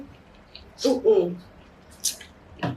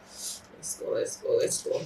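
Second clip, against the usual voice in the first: whispered self-pep talk; 13 dB quieter, slower than usual. Real, not acted. Psyching up while eating chilli.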